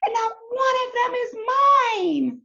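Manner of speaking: high, crying, furious wail; angry scream. Acted. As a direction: scream it like a tantrum. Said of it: "he was very angry... he screamed".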